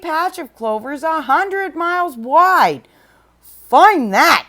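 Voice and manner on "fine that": louder, scornful sneer. Acted. The eagle mocking Horton's search, sneering. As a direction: sneer down at the elephant. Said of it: "sneered the bird".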